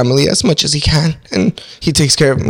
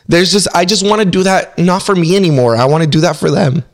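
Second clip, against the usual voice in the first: ordinary delivery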